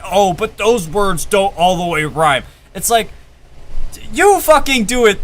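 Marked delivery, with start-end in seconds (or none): 0.0-2.4 whiny mock critic voice; lightly mocking nitpicker. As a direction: sneer it like a nitpicky listener. Mocking critics of imperfect rhymes.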